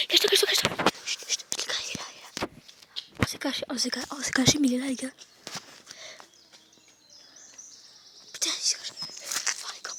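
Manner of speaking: hushed whisper, hiding; whispering while hiding. Real, not acted. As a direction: whisper so he can't hear. Hiding from the man, hushing. (chuchoter en se cachant)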